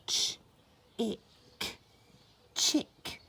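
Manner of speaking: "Ch-" then "ick" split apart, then "Chick" blended whole; sounding out. Shown as a demonstration. Teacher sounding out "chick" for children, slowly split. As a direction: sound out "chick" in two parts, then join them. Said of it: "Can we sound out chick".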